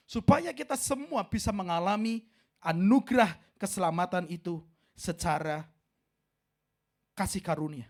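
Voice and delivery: loud, driving sermon voice; preaching. Acted. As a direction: preach it with rising force. Preaching at full force. (khotbah berapi-api)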